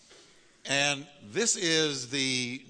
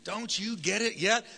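higher, slow, mock-exasperated question; teasing rebuke; 7 semitones higher than usual. Shown as a demonstration. Pastor demoing for the congregation to tease neighbors, higher. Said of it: "tell him".

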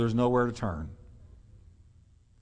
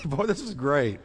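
ordinary talk

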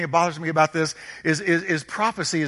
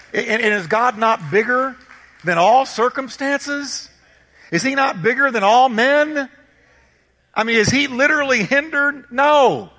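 high-pitched, building preacher's challenge ending on a loud NO; impassioned preaching; 7 semitones higher, 4 dB louder than usual. Real, not acted. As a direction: fire rhetorical questions at the congregation, pitch climbing. Preaches rhetorical questions at full force.

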